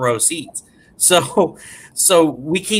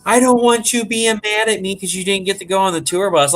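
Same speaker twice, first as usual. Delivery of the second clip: imitating crying wife; 7 semitones higher than usual. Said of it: "she's just, like, bawling".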